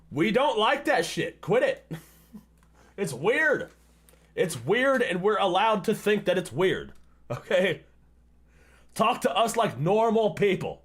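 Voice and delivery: high, loud scolding; exasperated rant. Real, not acted. Scolding women who come on too strong, loud and fed up. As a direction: lecture them, fed up.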